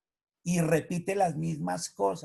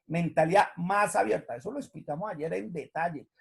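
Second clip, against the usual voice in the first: usual speaking voice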